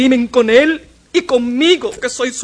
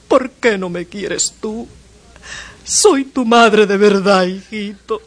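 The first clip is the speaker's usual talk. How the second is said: pleading mother. Acted. Mother begging her son Willy to love her, tearful. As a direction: beg the boy for love, close to tears.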